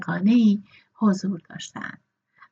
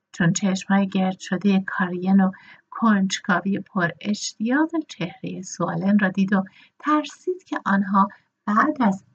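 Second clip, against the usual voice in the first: ordinary delivery, nothing marked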